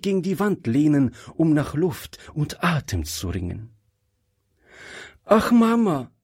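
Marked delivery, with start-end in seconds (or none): 5.2-6.1 put-on high-pitched exclamation from a girl; calling out. A narrator's reading. Girl clinging to her mother's hand, calling out high. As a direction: cry out in a much higher voice. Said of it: "rief Gretchen".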